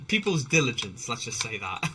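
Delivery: ordinary delivery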